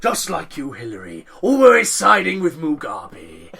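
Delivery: lightly voiced posh English, slowing at the end; a hint of Jack Whitehall; Jack Whitehall impression. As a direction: quote the sketch in a plummy drawl. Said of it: "that's from a Jack Whitehall sketch".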